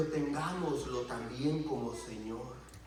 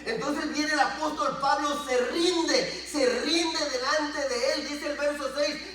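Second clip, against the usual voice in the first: raised, emphatic sermon delivery; forceful preaching; 10 semitones higher, 7 dB louder, slower than usual. Real, not acted. Preacher confronting the congregation about sin, forceful preaching. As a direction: press each phrase on the congregation.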